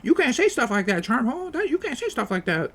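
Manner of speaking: high whiny scolding voice; mocking scolders. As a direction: squeak it out like prissy critics. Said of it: "People are like".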